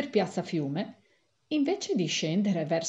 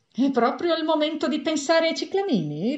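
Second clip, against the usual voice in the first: sarcastic retort; 8 semitones higher, 4 dB louder than usual. A narrator's reading. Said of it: "ribatté l'avvocato".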